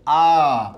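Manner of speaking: আ called out loud and open; teacher models আ; demo vowel a. Shown as a demonstration. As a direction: say আ clearly for the class. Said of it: "আমরা এগুলোর আলোচনা করব".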